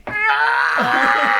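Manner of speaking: crying shout. Acted. Character cries out in tears.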